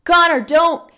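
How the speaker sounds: loud, high urgent cry; shouted warning. A narrator's reading. Oliven warning Connor at the burning house, shouting. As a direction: shout to stop him, over the noise. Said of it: "Oliven's voice sounded above the rest".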